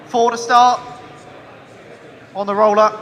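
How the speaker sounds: loud call to the room. Real, not acted. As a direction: project the opening bid across the hall. Auctioneer calling the opening bid.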